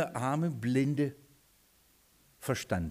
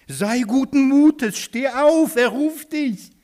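high, very loud, drawn-out cheering call; 10 semitones higher, 9 dB louder, slower than usual. Acted. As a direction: shout it across to the blind man, pitched way up. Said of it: "sie rufen den Blinden". (laut und hoch rufend)

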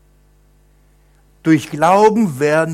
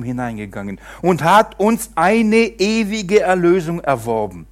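solemn raised Bible reading, slowing in places; scripture reading; 4 semitones higher, 4 dB louder than usual. Acted. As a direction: proclaim the verse to the congregation. Reads scripture aloud. (feierliche Schriftlesung)

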